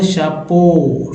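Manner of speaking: धीरे खींचकर "शपो"; "शपो" अक्षर अलग करके धीमे; sounding out sup. Shown as a demonstration. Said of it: "एस यू को स बोलेंगे". (धीमे उच्चारण में "शपो" बोलना)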